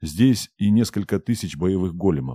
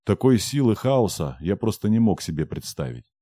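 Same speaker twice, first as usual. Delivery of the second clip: usual speaking voice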